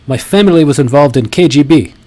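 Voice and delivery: mock Russian immigrant English, pushed up and loud; pseudo-Russian accent. Mimicking a speaker's newly acquired story, in a put-on Russian accent. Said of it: "he has a kind of Russian accent".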